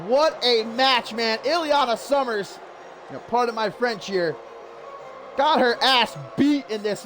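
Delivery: normal talking voice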